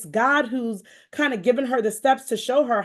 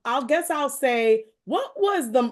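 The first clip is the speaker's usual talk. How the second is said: ordinary talk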